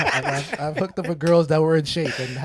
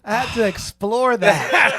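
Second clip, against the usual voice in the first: drawn-out, higher mock confession, half laughing; teasing mimicry; 4 semitones higher, much slower than usual. Friend mocks another man's awkward confession, teasing imitation. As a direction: slowly parrot him with amused disbelief. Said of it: "He was like".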